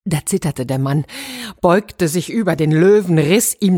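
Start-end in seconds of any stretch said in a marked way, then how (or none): none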